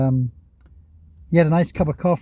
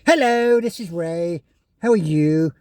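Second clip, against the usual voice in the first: a hint of a polite British woman greeting viewers; mocking a British YouTuber's greeting, lightly done; British lady impression. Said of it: "very British lady".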